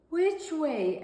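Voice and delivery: lightly voiced little bird. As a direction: ask it like a hopeful small bird.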